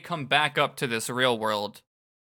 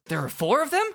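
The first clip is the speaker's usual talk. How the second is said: higher, rising shocked question; incredulous; 10 semitones higher, faster than usual. Acted. Skuld counting the darknesses, incredulous question. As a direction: pitch it up in disbelief.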